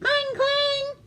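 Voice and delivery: high sing-song clang; bell clanging. Acted. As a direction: ring out like alarm bells. Imitated bells.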